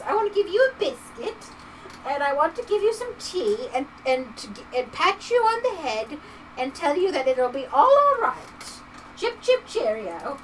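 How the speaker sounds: badly faked British accent, coddling; exaggerated, rusty British lilt; horrible British accent. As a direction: comfort someone in a hammed-up British accent. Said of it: "Sorry for the horrible British accent".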